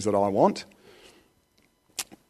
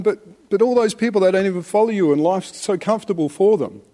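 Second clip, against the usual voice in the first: higher, pleading whine about others; mock whiny complaint; 5 semitones higher than usual. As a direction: imitate a self-pitying believer, pitched way up. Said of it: "And we're going".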